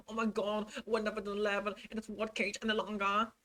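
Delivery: high, breathless, gushing devotion, racing in places; gushing assistant impression. Assistant gushing to Depp, high-pitched and adoring. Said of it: "this is the exact tone he is probably using here".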